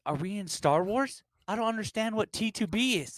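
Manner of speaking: high-pitched incredulous question; comic bafflement. Acted. Newcomer hearing another acronym, hammed-up high bafflement. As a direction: go way up in mock confusion.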